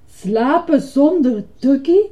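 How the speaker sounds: high, loud, slow disbelief; indignant cry. Acted. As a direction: cry it out as an appalled question. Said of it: "riep Ernie". (verontwaardigd uitroepen)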